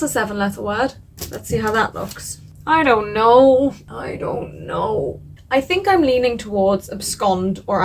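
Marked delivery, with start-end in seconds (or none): 2.7-3.7 drawn-out, louder whine of indecision; exasperated whine. Real, not acted. Agonising over the decision. 3.9-5.2 slow, soft, deflated groan of indecision; defeated mumble. Real, not acted. Agonising over the decision.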